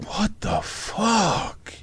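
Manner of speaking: slow, drawn-out, high incredulous gasp of a question; stunned disbelief. Retold or imitated. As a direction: stretch it out in total shock. Outraged reply to the rich client.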